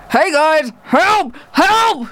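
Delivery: high-pitched, hammed-up distress calls; mock cry for help. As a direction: yelp for rescue, playfully desperate. Mock cries for help mid-show.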